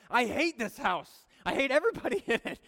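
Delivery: mock angry girl, high and loud; hammed-up tantrum of a preteen; sassy preteen outburst. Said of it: "Things like".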